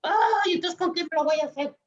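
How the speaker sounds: a hint of a high kid's voice, rushing; child wondering what to do, quickly; frustrated child. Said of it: "Entonces dice".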